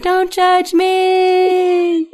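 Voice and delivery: high, long-held wail of embarrassment; drawn-out mock scream. Parent screaming behind child's house tour, mock wail. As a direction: stretch it into a high, comic wail. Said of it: "I'm just screaming in the background".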